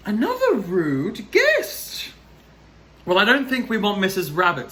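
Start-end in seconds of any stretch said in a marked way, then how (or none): 0.1-2.1 slow, higher, gasping mock outrage; mock shock. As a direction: gasp and pretend to be scandalised. Reader reacts to rude guest.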